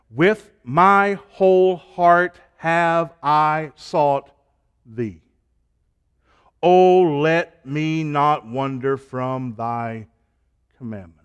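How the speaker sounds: slow, solemn reading of a psalm, drawn out; scripture recitation. Acted. Preacher reciting a psalm to the congregation, slow solemn scripture reading. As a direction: read the verse slowly, like a prayer.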